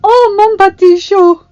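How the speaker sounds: big, high, ringing French endearment; songlike cry. A narrator's reading. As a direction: sing it out loud and slow across the hall. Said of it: "she cried. Her voice was like a song".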